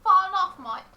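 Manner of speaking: put-on Australian accent. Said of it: "Australian accent".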